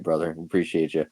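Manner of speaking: ordinary talk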